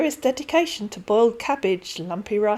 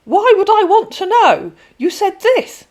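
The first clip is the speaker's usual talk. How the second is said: much higher, louder bristling scammer; defensive scammer; 12 semitones higher, 11 dB louder than usual. Acted. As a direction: deflect with irritation. Voices scammer.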